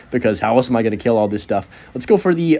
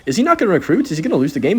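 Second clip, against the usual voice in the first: amazed disbelief; 6 semitones higher, faster than usual. Real, not acted. Opponent suddenly stops playing.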